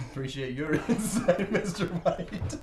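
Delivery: breaking into laughter mid-sentence; slow down and giggle through the words; laughing. Real, not acted. Laughing through an in-game thanks.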